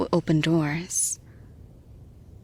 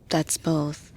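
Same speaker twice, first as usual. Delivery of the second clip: usual speaking voice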